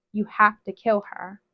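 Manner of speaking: plain reading voice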